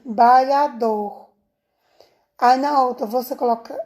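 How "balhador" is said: BALHADOR drawn out at half speed; rest of word, slowly. Shown as a demonstration. Teacher placing the rest on the next line, BALHADOR slow and separated. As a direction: stretch BALHADOR slowly as the word's remainder. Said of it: "aí aqui".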